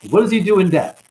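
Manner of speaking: ordinary talk, nothing marked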